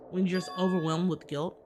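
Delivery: ordinary talk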